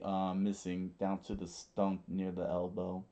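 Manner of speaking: usual speaking voice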